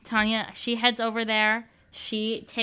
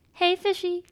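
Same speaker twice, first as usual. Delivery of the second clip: coaxing pet call; 8 semitones higher than usual. Acted. Calling Buffalo over.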